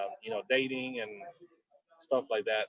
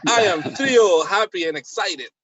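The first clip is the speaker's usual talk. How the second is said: mock excitement; 5 semitones higher, 4 dB louder than usual. Opening the show with mock hyped excitement. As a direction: announce it like a hyped host.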